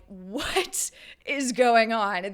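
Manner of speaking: slow, loud, confused question; bewildered. Retold or imitated. Retold reaction at movie theater.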